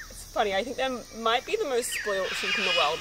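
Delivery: ordinary delivery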